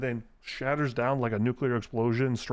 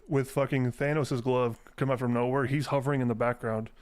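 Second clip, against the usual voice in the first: normal talking voice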